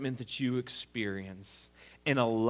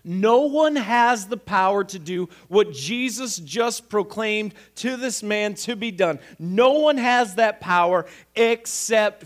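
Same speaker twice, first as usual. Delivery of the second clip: higher and louder, driving sermon voice; preacher hammering home who can forgive sins; preaching at full force; 8 semitones higher, 8 dB louder than usual. Real, not acted. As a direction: preach it hard, building the case.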